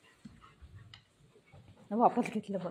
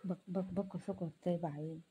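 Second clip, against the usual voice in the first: low hushed amused comment; hushed aside; 5 semitones lower, 12 dB quieter than usual. Real, not acted. Mother remarks to viewers on the pet, hushed. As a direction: drop to near-murmur while watching. Aside about the pet's antics.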